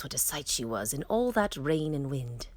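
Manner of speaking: ordinary delivery, nothing marked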